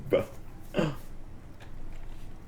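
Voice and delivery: dramatic gasp. Acted.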